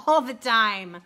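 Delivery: real laugh into a loud admission. Real, not acted. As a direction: burst out laughing, then admit it loudly. Laughs after her sarcastic denial.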